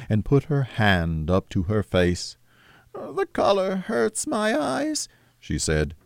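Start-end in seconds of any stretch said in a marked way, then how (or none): 2.9-5.1 high, slow, tender mother's voice; sad mother. Acted. Grieving mother excuses her tears, high and slow. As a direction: hide the grief behind an excuse.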